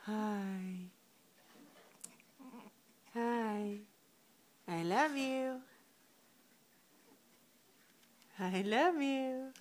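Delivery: soft, slow loving coo. Real, not acted. Tender whispers to baby.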